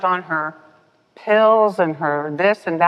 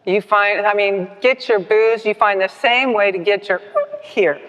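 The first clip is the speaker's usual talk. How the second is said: put-on a louder, firm old man lecturing; old man in a meeting scolding Becky, louder; stern old man; 4 semitones higher, 5 dB louder than usual. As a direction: jab the point home like a scolding old-timer. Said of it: "pointed his finger at Becky".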